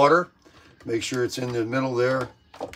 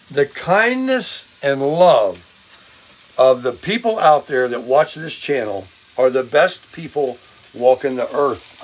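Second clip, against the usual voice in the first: ordinary talk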